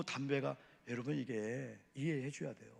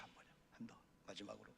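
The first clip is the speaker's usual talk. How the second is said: quick whispered count-in; whispered cue; 4 semitones lower, 19 dB quieter, faster than usual. Real, not acted. Pastor cueing the congregation to read, whispered.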